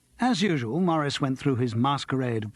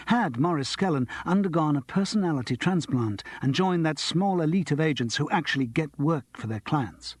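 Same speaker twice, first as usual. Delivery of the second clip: ordinary delivery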